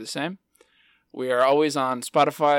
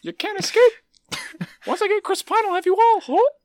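loud, very high squeaky mouse; a villainous squeaking cartoon mouse; Mickey Mouse voice; 6 dB louder than usual. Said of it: "Mickey Mouse Voice".

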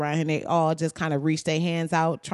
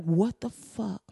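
stunned; 5 dB quieter, slower than usual. Retold or imitated. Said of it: "you like".

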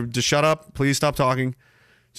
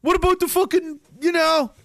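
appalled rant; 12 semitones higher than usual. Retold or imitated. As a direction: rail at them like an appalled kid. Ranting as appalled child.

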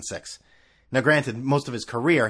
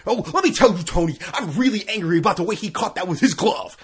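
raised, louder pundit rant parody; mocking Michael Wilbon; 6 semitones higher, 4 dB louder than usual. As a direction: mimic an outraged TV pundit, hammed up. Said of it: "Michael Wilbon's entire fucking life".

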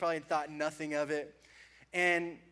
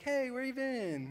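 slow, easy friendly greeting; lightly friendly, slow and easy; 6 semitones higher, 4 dB quieter, slower than usual. Pretend. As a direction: say it like a casual hello. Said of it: "I like to think it's like".

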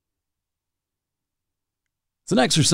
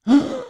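sharp mock gasp; 13 semitones higher than usual. Pretend seeing tidal wave.

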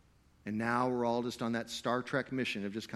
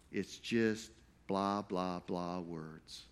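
dismissive; 7 semitones lower, 7 dB quieter, much slower than usual. Retold or imitated. Dismissive mock of empty words.

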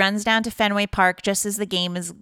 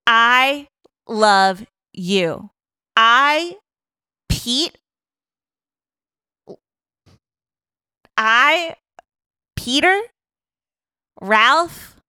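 loud, rising, halting announcement; mock grand declaration; 4 semitones higher, 5 dB louder, much slower than usual. Pete declares his love on the Jumbotron, in a mock grand announcement. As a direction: proclaim it haltingly, each word held up high.

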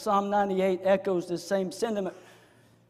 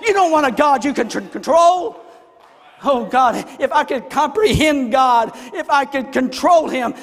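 loud, raised preacher's voice; full-force preaching; 7 semitones higher, 11 dB louder than usual. Real, not acted. Preacher telling congregation they want a sovereign God, loud and forceful.

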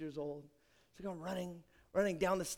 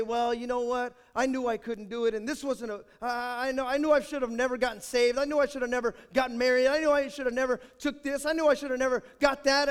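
rising, louder self-pitying complaint; whining complaint; 4 semitones higher, 6 dB louder than usual. Pretend. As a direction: whine out every regret, louder each time. Mock complaint of a defeated believer.

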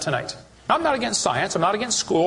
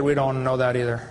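a lower, hesitant professor; professor's voice; 6 semitones lower than usual. Retold or imitated. Professor admitting ignorance to the questioner, lower. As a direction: drop down into the cornered professor.

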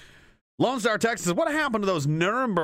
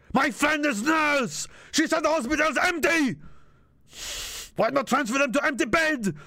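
mocking voice; 7 semitones higher than usual. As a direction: sneer at the broken English. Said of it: "why I'm saying it like that".

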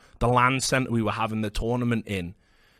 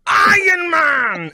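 high, loud, slow battle cry; a slow, loud mock superhero scream; 11 semitones higher, 7 dB louder, much slower than usual. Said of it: "that was when he screamed".

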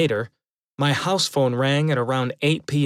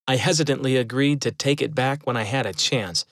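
ordinary delivery, nothing marked

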